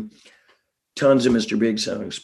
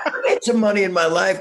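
laughing; 7 semitones higher, faster than usual. Real, not acted. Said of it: "not Apple".

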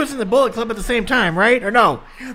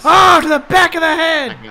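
excited shout; 6 semitones higher, 7 dB louder than usual. Real, not acted. Reacting to a kick.

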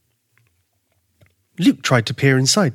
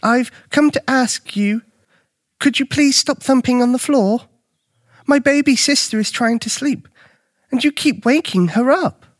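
put-on high, pleading boy's voice; young Luke, earnest complainant; 11 semitones higher than usual. A narrator's reading. Luke asks the stranger to stop thumping, pleading politely. Boy character in story.

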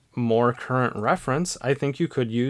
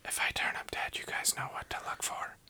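soft conspiratorial whisper; 12 dB quieter than usual. Pretend. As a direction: hush it like a secret to listeners. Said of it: "I can hear you whispering".

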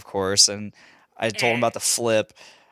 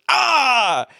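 mock battle cry; 13 semitones higher, 6 dB louder than usual. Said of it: "comes out of his room and goes".

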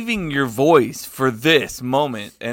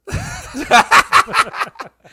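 loud laugh; 7 dB louder than usual. Real, not acted. Reacts to Jason's voice.

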